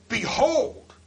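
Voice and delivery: high, loud ringing BEHOLD; emphatic proclamation. Acted. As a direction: call it out like a command that demands attention. Proclaims the powerful word Jesus said.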